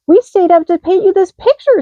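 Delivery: high loud squeaky mice; proud little mouse voices; baby mice give gift, squeaky. A narrator's reading. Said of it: "squeaked the baby mice".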